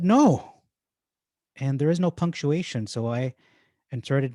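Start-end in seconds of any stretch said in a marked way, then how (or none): 0.0-0.6 sharp high loud no; exclamatory no. Acted. Caleb stopping the people with a high exclaimed no. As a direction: cut them off with one commanding word. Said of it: "he said this with an exclamation mark".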